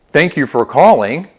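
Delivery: slow, projected greeting; phone greeting. Shown as a demonstration. Coach models answering a customer's call, upbeat greeting. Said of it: "we're gonna say".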